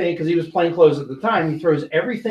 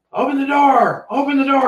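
retold yelling; 6 semitones higher than usual. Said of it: "And I hear him yelling".

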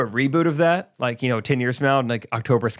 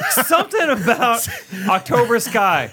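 high laughing wonder; 10 semitones higher, slower than usual. Real, not acted. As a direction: laugh through the words. Laughing at the fall joke.

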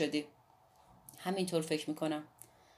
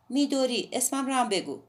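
put-on lightly raised, louder young woman's voice; a defiant girl explaining herself; Midori's voice; 6 semitones higher, 5 dB louder than usual. A narrator's reading. A lightly voiced Midori telling Watanabe why she undressed, raised and louder. Midori demands her name.

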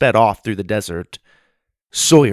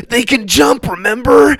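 very high, loud shout on the run; panicked shout; 14 semitones higher, 8 dB louder than usual. Acted. Sawyer shouting at Susie while fleeing, panicked high shout. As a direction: yell back in a panic. Running from kangaroo.

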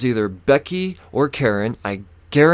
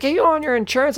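high-pitched mocking demand; mimicked officer; faster than usual. Officer turning on the passenger, high mocking voice. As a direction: parody her questioning, pitched way up. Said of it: "looks at me, she's like".

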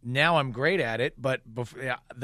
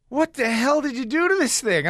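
higher, louder mock outrage from the wife; wife's higher, louder outrage at the flattened steak; retold wife's outrage; 9 semitones higher, 4 dB louder than usual. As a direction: play the fiancée aghast at the ruined steak. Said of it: "she goes".

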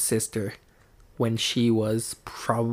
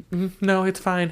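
higher-pitched mock denial; higher, evasive kid impression; mimicked brush-off; 7 semitones higher than usual. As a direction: imitate a child insisting nothing's wrong. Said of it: "changes her attitude".

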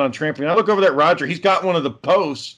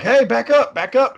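warning the dog off; 8 semitones higher than usual. Retold or imitated. Warding off the biting dog.

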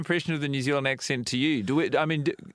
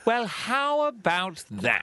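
much higher, slow "Well, how about that?" in a posh English lilt; old English accent; 9 semitones higher, slower than usual. Shown as a demonstration. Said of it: "The difference between this and this".

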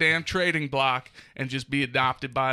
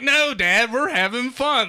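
put-on high, loud, pleading kid voice; mock the children begging to stay; dad mimicking his kids refusing to leave; whiny kids; 8 semitones higher, 6 dB louder than usual. Dad imitates his whining kids.

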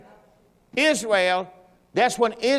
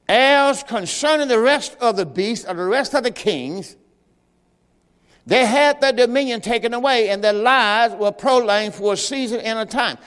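scripture reading; 3 semitones higher, 3 dB louder than usual. Acted. Reads scripture aloud.

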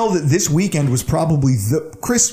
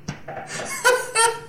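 high laugh; 6 dB quieter than usual. Real, not acted. Laughing at own story.

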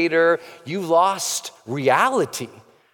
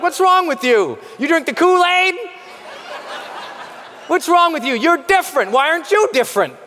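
mock incredulous; 10 semitones higher than usual. People pointing at you mocking.

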